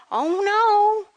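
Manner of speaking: high, slow American insistence; American lady. Retold or imitated. Surgeon's wife insists the girls wear skirts, high American voice. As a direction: insist sweetly but firmly. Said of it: "we were like".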